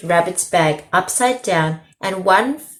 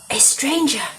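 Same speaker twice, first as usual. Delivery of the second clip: gasped; 7 semitones higher than usual. A narrator's reading.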